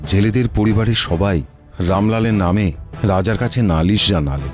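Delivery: ordinary delivery, nothing marked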